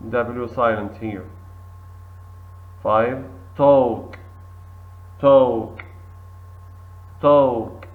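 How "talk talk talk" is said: talk, no L, loud; model word. Shown as a demonstration. Teacher models talk loudly for the class. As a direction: talk said tawk, L silent, loud. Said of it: "L silent".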